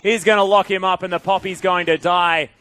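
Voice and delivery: high, loud esports shoutcast; excited play-by-play. Real, not acted. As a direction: call the kill at full force, voice lifted. Live play-by-play at full pitch.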